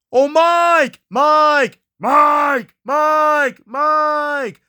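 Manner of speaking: high, drawn-out repeated scream; screaming for Mike. Acted. Lost friends calling Mike at three twenty-eight, screaming. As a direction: scream the name out high, over and over. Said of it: "Let us scream for Mike".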